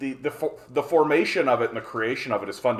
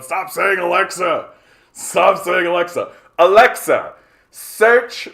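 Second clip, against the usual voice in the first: higher, louder frantic yelling; mock annoyed chat; 7 semitones higher, 10 dB louder than usual. Streamer to chat, voicing viewers whose Alexa wakes, loud mock yell. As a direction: pretend to be fed-up viewers shouting. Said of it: "are now going".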